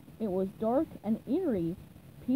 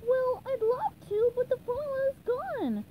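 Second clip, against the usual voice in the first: very high cartoon explaining voice; high-pitched, earnest character voice; SpongeBob; 11 semitones higher than usual. A narrator's reading. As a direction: explain it up in a squeaky register. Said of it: "SpongeBob explained".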